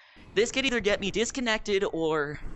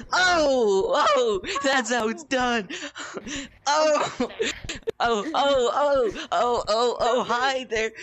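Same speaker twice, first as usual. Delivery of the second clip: excited gloating; 5 semitones higher, 5 dB louder, slower than usual. Real, not acted. Gloating after catching opponent.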